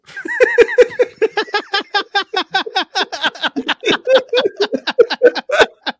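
high, loud helpless laughter; long high laugh. Real, not acted. Contestant cracking up at his own answer Finland with a long high laugh. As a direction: let the laugh run on.

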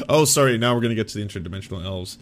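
normal talking voice

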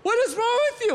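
high-pitched alarmed questions; mock a panicking wife, pitch way up; imitated freaked-out wife. Wife to pacing husband, mock freak-out. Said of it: "freaking out".

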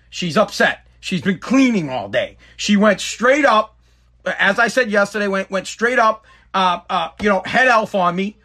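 ordinary delivery

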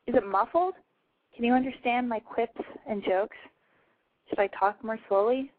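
ordinary delivery

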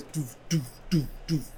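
imitating a booming bass beat; bass thump noises. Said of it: "they go like that".

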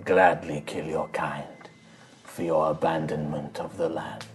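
low, whispery, slow shaman voice; menacing shaman. Acted. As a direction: threaten quietly, drawing out the words. Game master voices the shaman.

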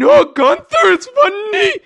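loud, very high mock hilarity; imitating her laughter. Teasing the co-host for laughing at Gunther, mock-hysterical. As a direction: squeal it out, mimicking someone cracking up. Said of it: "I like how you're laughing".

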